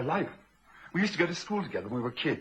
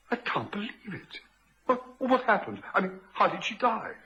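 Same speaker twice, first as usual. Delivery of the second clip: high, halting, stunned questions; stammering shock, pitched high; shocked disbelief; 8 semitones higher than usual. Acted. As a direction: struggle to take in sudden bad news. Reacting to news of death.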